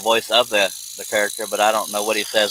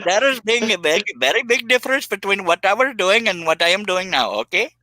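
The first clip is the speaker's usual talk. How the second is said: pitched-up, mock Welsh accent; 8 semitones higher than usual. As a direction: play it as an overdone Welsh lilt. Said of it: "you're being Welsh there".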